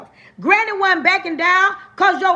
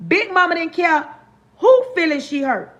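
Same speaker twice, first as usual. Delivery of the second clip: ordinary talk, nothing marked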